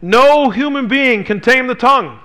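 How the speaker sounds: "no" pushed up and stressed before the whole line; emphatic no. Shown as a demonstration. Pastor quoting verse eight, with stress on "no". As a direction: land heavily on "no", then finish the verse. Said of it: "in the Greek it's emphatic".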